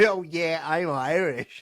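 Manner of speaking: put-on American accent. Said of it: "puts on this American accent".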